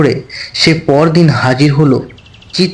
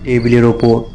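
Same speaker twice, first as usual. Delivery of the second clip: usual speaking voice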